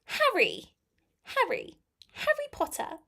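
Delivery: high-pitched, hammed-up British accent; very British, pitched well up. Shown as a demonstration. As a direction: play up the British "Harry Potter". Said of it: "let me do my British accent first".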